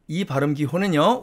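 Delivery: usual speaking voice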